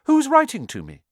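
high, urgent, loud question; loud demand. A narrator's reading. Boy questioning uncle about the letter, loud demand. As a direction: press for an answer.

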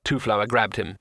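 plain reading voice

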